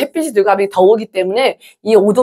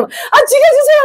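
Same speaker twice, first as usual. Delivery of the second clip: high-pitched hammed-up cry of despair; dramatic mock plea; 3 dB louder than usual. Preacher acting out a spouse upset at a cool husband, mock plea. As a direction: wail it far higher, in mock desperation.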